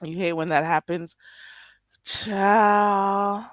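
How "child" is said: ordinary talk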